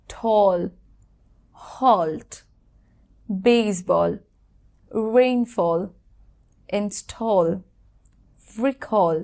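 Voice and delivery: each word said slowly and clearly as a model; slow careful model words, a pause after each; model pronunciations. Shown as a demonstration. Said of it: "এ এর উচ্চারণ হবে অ".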